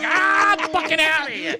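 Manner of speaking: high-pitched yelp of retreat; hammed-up cry of escape; retold panicked exit. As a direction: blurt it high as you bolt. Retold flight from the scene.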